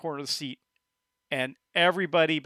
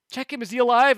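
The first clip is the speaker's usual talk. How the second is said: higher, pleading and anxious; worried wife; 6 semitones higher, 5 dB louder than usual. Acted. Wife begging others to check her fallen friend, anxious plea. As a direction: beg someone to check on him. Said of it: "You hear the wife".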